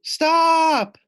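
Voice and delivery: very high, loud wail; wailing shout. Acted. Loony Little wailing at her friends to stop. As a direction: scream it in alarm. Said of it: "Loony Little wailed".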